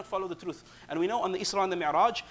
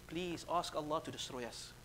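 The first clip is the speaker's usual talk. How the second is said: pleading; 9 dB quieter than usual. Retold or imitated. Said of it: "They can only now plead and beg".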